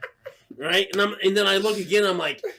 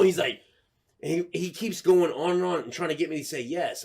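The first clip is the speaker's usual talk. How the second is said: ordinary talk, nothing marked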